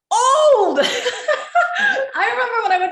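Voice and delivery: high, loud laugh through the word. Real, not acted. Laughing at turning forty.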